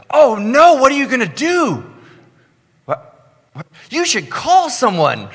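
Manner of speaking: very high, loud, frantic voice; mock panicking operator. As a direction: ham up a dispatcher losing it.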